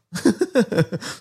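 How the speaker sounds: high burst of laughter; real laughter. Real, not acted. Man laughing after telling the curious to live their lives, high-pitched.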